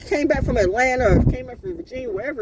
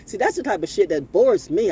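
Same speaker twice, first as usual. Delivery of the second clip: ordinary talk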